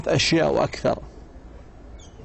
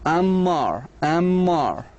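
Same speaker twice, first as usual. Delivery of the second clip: "Ammar" drawn out as Am-mar; two long beats on "Ammar"; syllable by syllable; 3 semitones higher, much slower than usual. Shown as a demonstration. Teacher uses a name as syllable example, drawn out. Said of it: "for example. So we have syllables".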